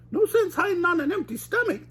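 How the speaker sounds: high Shaggy, not actually whispered; hungry scheming Shaggy; mock whisper in high Shaggy voice. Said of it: "Shaggy whispered".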